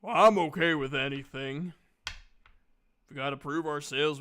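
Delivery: ordinary talk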